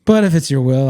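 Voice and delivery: pleading Jesus. Retold or imitated. Jesus begging God before the crucifixion, lightly pleading. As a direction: beg gently to be spared, half-acting it. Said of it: "sounds like, uh, Jesus before he got crucified".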